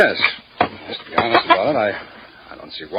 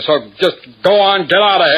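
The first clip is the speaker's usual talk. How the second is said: angry; 5 semitones higher, 4 dB louder than usual. Acted. Angry owner throws him out.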